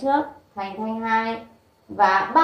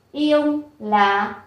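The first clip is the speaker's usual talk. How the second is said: ordinary talk, nothing marked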